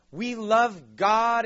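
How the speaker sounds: high, loud, stretched-out gushing; mock weeping devotion. People walking out of church, hammed-up tearful devotion. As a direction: imitate overcome churchgoers at full volume.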